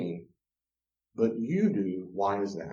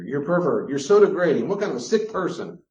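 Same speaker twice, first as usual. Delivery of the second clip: higher, louder scolding; mock outrage; 5 semitones higher, 7 dB louder than usual. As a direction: shame the husband loudly. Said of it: "Before boundaries".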